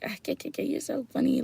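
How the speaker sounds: high, soft fake giggle; mocking imitators. Mockers copying her Southern talk, teasing mimicry. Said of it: "they'll copy it".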